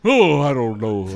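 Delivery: hammed-up hesitant lion; Wizard of Oz lion. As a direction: stay in the lion impression, amused. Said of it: "in that voice".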